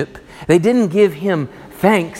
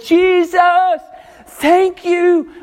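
much higher and louder, bursting with thanks; grateful cry; 13 semitones higher, 4 dB louder, slower than usual. Acted. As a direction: cry out your thanks to Jesus. Voicing a grateful believer's prayer.

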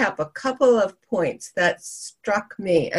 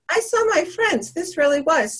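pleased guest; 6 semitones higher than usual. Retold or imitated. Voicing guest's happy reaction.

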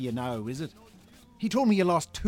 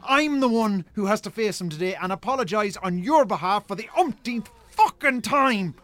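angry tirade; 6 semitones higher, 5 dB louder than usual. Acted. Brother berating him after poker.

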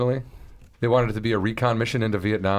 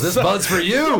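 slow, higher, louder mock beer ad voice; ad announcer; 8 semitones higher, 4 dB louder, slower than usual. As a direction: sell it like a cheesy beer commercial. Parody Budweiser ad tagline.

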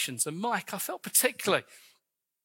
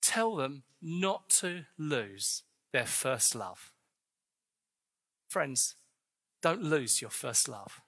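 tender plea; 5 semitones lower, 4 dB quieter, slower than usual. Real, not acted. Preacher's tender plea to church.

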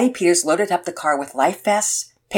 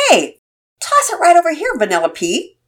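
yelling; 11 semitones higher, 7 dB louder than usual. A narrator's reading. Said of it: "Todd yelled".

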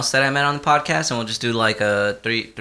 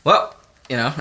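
normal talking voice

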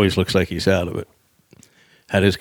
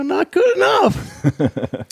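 high, long-drawn yelled refusal; defiant mock shout; much slower than usual. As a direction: throw it back at the guard, stretched out high. Retold defiant refusal to border guard.

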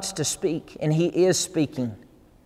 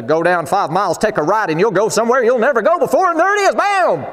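mock booming directions; 5 semitones higher, 4 dB louder than usual. Preacher mocking the idea of exact directions, building to a loud bam. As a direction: pitch climbing higher and louder to a shouted bam. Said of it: "It wasn't a".

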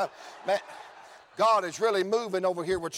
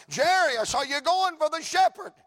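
calling bystander; 7 semitones higher, 4 dB louder than usual. Acted.

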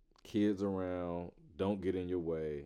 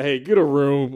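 teasing call; 6 semitones higher, 12 dB louder than usual. Retold or imitated. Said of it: "I'm like".

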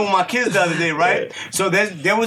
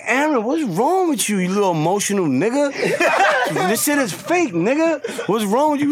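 higher-pitched, incredulous telling-off; mock exasperation at a child; retold scolding; 6 semitones higher than usual. Dad retelling how he scolded his emotional son, mock scolding.

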